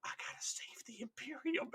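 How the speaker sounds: zealous hushed whisper. Acted. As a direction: whisper it like a secret vow. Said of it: "he would whisper it".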